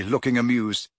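ordinary delivery

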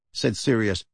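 plain reading voice

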